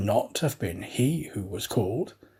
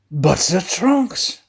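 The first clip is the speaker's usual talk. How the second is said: slow, higher, louder alarmed gasp; gasped protest; 6 semitones higher, 7 dB louder, slower than usual. A narrator's reading. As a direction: gasp it in panic about the luggage. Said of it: "gasped Passepartout".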